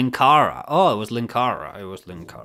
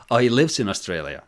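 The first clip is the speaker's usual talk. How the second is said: ordinary talk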